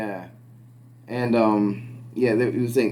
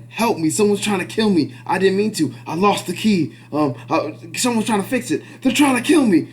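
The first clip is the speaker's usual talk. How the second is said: high, rising panicked pleading; horror movie victim; 7 semitones higher, 3 dB louder than usual. Retold or imitated. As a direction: play the victim opening a horror film. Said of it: "that sounds like a".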